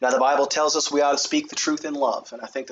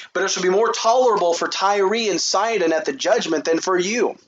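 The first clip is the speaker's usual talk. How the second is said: preaching at full force; 5 semitones higher than usual. Acted. Jesus warning Chorazin and Bethsaida, read as thundering preaching. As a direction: thunder the woes at the cities. Proclaims the woes of verse thirteen.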